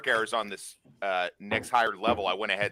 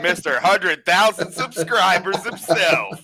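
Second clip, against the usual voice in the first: laughing announcement; 9 semitones higher, 7 dB louder, slower than usual. Real, not acted. Greeting streamer arriving live.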